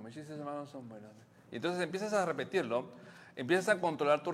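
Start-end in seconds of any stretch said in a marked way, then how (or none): 0.0-1.1 Mis chistes malos son buenos said much softer, nearly murmured; last repetition almost a whisper; fading mantra. Shown as a demonstration. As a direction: let the mantra fade into sleep. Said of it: "te vas a repetir a ti mismo".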